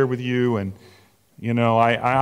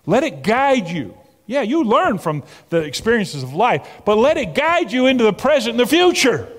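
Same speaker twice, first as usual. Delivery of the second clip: building intensity; 7 semitones higher, 5 dB louder than usual. Acted. Sermon building to climax.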